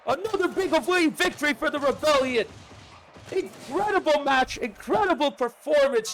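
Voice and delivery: fast, high, energetic commentary; live play-by-play. Real, not acted. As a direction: call the match live.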